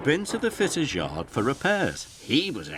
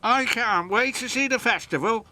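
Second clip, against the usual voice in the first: high, louder small engine voice; a little engine peeping with excitement; 13 semitones higher, 4 dB louder, faster than usual. A narrator's reading. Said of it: "peeped Percy".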